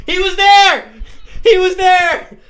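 high, loud excited insistence; excited shout. Real, not acted. Telling his friend he saw the guy, shouting high. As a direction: burst out, really sure of it.

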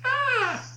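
very high mimicked shriek; imitated scream. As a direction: answer with a piercing wail. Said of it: "heard another scream".

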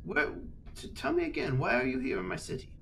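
high, airy voice of a haughty lord; lordly old nobleman. Acted. Lord addressing the girl, high and haughty. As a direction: play the lord talking down to a child.

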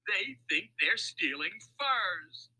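high, slow, incredulous amusement; amused outcry. A narrator's reading. Mac laughs at the mistaken thieves, amused outcry. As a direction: cry it out slowly, tickled by it. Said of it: "he cried when he could finally speak".

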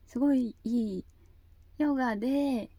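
ordinary delivery

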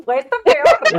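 loud laughing cry on peor; laughing outburst. Real, not acted. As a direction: burst out laughing as you agree. Laughing, agreeing emphatically.

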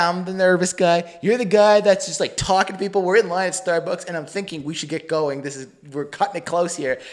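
ordinary talk

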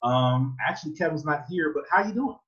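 high-pitched imitation of a flirty mom; flirty friend's mother. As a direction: lift it high, rising into a come-on. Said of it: "All their mothers loved me".

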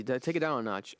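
ordinary delivery

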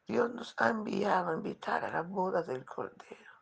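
ordinary talk

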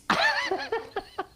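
very high squeaky laugh; high laugh at your own joke; real laugh. Real, not acted. Laughing at his zen joke.

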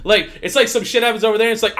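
usual speaking voice

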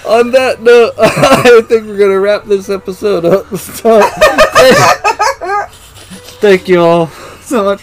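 high, giggling outro through laughter; laughing through words. Real, not acted. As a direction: try to speak while cracking up. Can't stop laughing at outro.

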